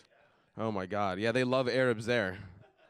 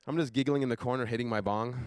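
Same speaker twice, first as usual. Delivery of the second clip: ordinary talk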